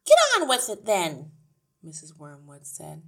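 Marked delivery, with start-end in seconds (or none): 0.1-1.3 high, loud put-on woman's voice; impatient wife. A narrator's reading. Wife wants her program back, impatient. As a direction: hurry her along with loud irritation.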